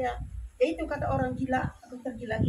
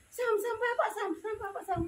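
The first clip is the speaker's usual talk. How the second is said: urgent calling; 9 semitones higher than usual. Retold or imitated. Mother calling Samuel to his father, urgent and high. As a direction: call the boy to come quickly.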